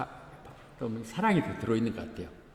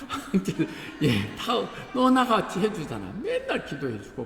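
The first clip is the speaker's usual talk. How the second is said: higher, laughing through the words; laughing tease; 9 semitones higher than usual. Real, not acted. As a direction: tease her fondly while laughing. Teasing a congregant by name. (웃으면서 놀리듯)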